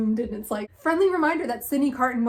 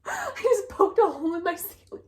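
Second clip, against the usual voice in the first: laughing; 10 semitones higher than usual. Real, not acted. Poked a hole in ceiling.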